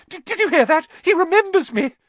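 very high, loud joyful squeal; delighted Diggle. Acted. Diggle telling the pub Harry remembers, high squeal. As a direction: crow it to the room.

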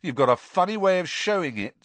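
snide retort; sneering. A narrator's reading. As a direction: throw it back with scorn. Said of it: "sneered Ron".

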